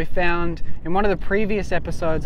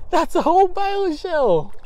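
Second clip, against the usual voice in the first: "whole" lifted high, the line said in a much higher delighted voice; thrilled; 11 semitones higher than usual. Real, not acted. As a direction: squeal out that it's whole. Delighted at the find.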